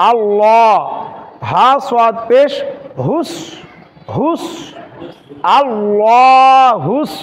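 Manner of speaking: slow, drawn আল্লাহুস with heavy rounded 'law'; thick-lam recitation. Shown as a demonstration. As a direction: spell out, then stretch আল্লাহুস with a dark L. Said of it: "একটু আগে যেটা বললাম ওটাই". (আল্লাহুস-এর লাম মোটা করে, টেনে)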